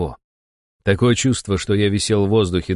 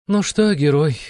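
higher-pitched irritated woman curator; irritated demon boss in a raised pitch; hoarse, irritated; 8 semitones higher, 3 dB louder, slower than usual. A narrator's reading. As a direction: greet him with weary irritation, voice pitched up. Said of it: "Голос кураторши прозвучал хрипло и раздражённо".

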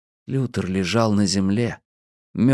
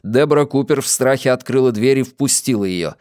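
ordinary delivery

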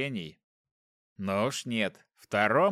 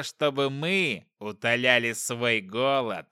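slow, menacing demon growl; drawn-out monstrous threat; 3 dB louder, slower than usual. Acted. Demon answers captor.

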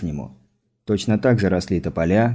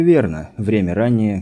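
ordinary delivery

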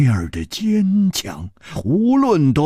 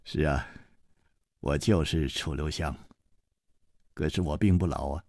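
put-on calm older hero; 12 semitones lower, 10 dB quieter than usual. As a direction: speak low and unhurried, gently reproaching. Chu Liuxiang answers.

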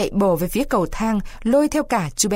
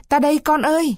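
calling back; 6 semitones higher than usual. Acted. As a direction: shout back to the boy I'm here. Father answers call.

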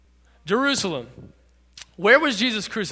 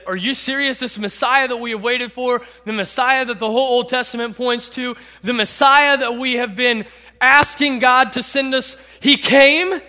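guilty disbelief; 4 semitones higher, 4 dB louder than usual. Acted. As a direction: build from incredulous question to heavy self-accusation. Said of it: "Can you imagine the guilt".

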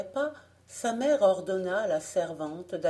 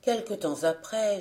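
usual speaking voice

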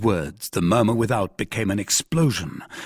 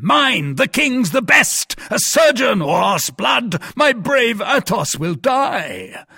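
high, loud desperate cries; frantic shouting; 11 semitones higher, 5 dB louder, slower than usual. A narrator's reading. Captain calling for a surgeon over the fallen man, frantic. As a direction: shout for help as if a life depends on it. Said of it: "cried Monsieur de Tréville".

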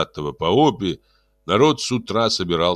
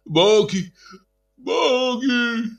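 high wailing crowd cry; вопль толпы; much slower than usual. A narrator's reading. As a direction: wail it out, pleading. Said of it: "завопила толпа". (вопль толпы)

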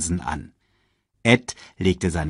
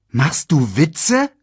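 high, slow, loud question; incredulous; 8 semitones higher, 6 dB louder, slower than usual. A narrator's reading. Hyena stunned by the boss's plot, slow and incredulous. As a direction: ask it stunned, pitch climbing. Shenzi's mouth hangs open.

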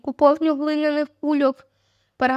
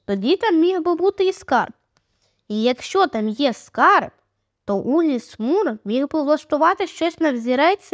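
ordinary delivery, nothing marked

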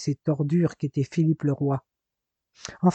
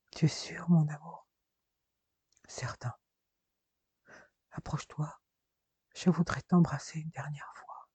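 faint unvoiced whisper, much softer; dying whisper; 10 dB quieter than usual. A dying Marina asks Jason for a last kiss, in a faint whisper. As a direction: breathe the last words out, fading.

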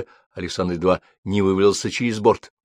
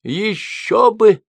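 laughing; 13 semitones higher, 5 dB louder, much slower than usual. A narrator's reading. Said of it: "захохотала Виола".

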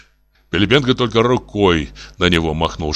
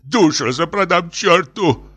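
high, drawn-out desperate cry; hysterical; 10 semitones higher, slower than usual. A narrator's reading. Professor offers his soul, hysterical high cry. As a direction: wail as hysteria sets in. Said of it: "начиналась истерика".

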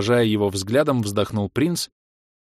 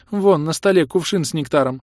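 sobbing; 6 semitones higher, 3 dB louder than usual. A narrator's reading. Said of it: "всхлипывая".

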